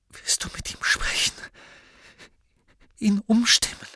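high, breathy, broken pleading; losing her nerve. A narrator's reading. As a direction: beg in tearful whispers with halting pauses. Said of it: "schien die Nerven zu verlieren".

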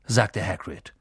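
plain reading voice

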